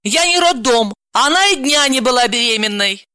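very high exasperated retort; annoyed, indignant woman; irritated. Acted. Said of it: "раздражённо спросила".

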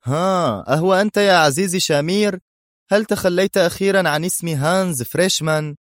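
high-pitched taunting hero; hero taunting his enemy. A narrator's reading. Hero greets his old enemy. (صوت بطل يتهكم بنبرة مرتفعة)

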